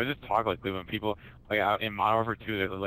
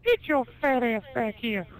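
very high, shrill-pitched cartoon holler; cartoon yell; 5 dB louder than usual. Retelling the cartoon scene, yelling after the paperboy in a very high voice. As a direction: screech it after the kid biking off.

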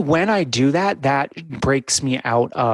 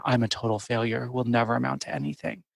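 heavy self-judgment; 2 semitones lower, 7 dB quieter, faster than usual. Shown as a demonstration. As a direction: say it as a grim, quiet verdict on yourself. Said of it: "has such a different tone than".

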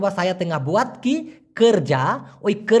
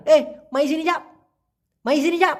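এ মাই সিনি যা pitched up with a calling village twang; local Malay twang; 6 semitones higher than usual. Shown as a demonstration. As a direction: call someone over in the local twang. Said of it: "ওদের টানে".

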